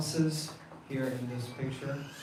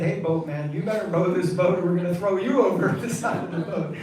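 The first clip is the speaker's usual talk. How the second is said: mock threat; 3 semitones higher, 8 dB louder than usual. Gopis threaten boatman.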